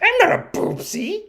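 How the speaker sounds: tiny, very high protest; imitating an indignant child; insulted little girl. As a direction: squeak it like an affronted small kid. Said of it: "she really takes it as an insult".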